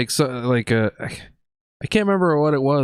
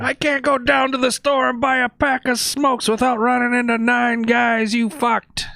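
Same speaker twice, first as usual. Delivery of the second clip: movie quote impression; 7 semitones higher, slower than usual. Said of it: "you added in from Boondock Saints".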